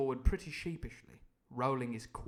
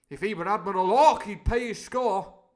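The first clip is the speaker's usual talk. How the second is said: loud indignant declaration; crying out; 7 semitones higher, 13 dB louder than usual. Acted. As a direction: roar out the principle. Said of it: "cried Silver".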